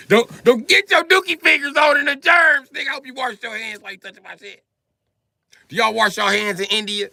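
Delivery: high, loud scolding shout; mock outrage. Comic yells at the clerk not to touch his phone, mock outrage. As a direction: yell at him to keep his dirty fingers off. Yelling at clerk about germs.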